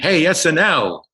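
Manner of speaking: high, slow mock heckle; a cab driver mocking an SNL actor, slow high jeer; heckling cab driver. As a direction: jeer it like a passing cabbie. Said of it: "a cab driver went".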